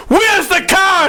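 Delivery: high, slow mock shout; retold yelling. Tow guy ringing the doorbell, mock shouted demand.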